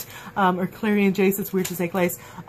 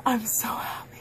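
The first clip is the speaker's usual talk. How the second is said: breathless whisper of happiness; whispered joy; 3 semitones higher, 7 dB quieter than usual. Real, not acted. A fan whispering her joy at the kiss, whispered. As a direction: hush it out, overwhelmed with joy. Gushing about the first kiss.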